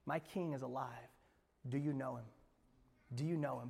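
quiet reprise. Acted. Pastor asking the congregation personally, soft and intimate. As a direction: ask it close and gentle. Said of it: "same question asked quietly".

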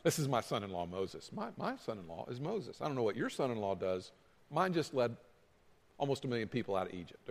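low, soft boast about a son-in-law; bragging father-in-law. Acted. Father-in-law boasting at work about Moses, low-key brag. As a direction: brag quietly, pitched well down.

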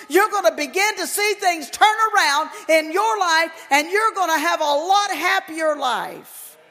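loud, high, ringing preacher's exhortation; preaching at full force. Acted. Preacher urging his congregation, in a loud, ringing full-force sermon voice. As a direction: preach it out to the whole church.

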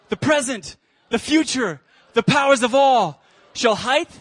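high, loud fiery sermon call, each item flung out; preaching at full force. Acted. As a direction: drive the list home and rally the room to shout back. Preacher fires down the list.

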